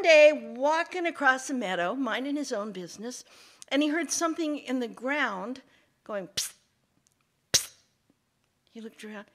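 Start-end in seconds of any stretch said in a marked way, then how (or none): 6.3-7.7 short secretive psst to get attention; psst, hissed at a passer-by. Acted. Sound from the ground calling.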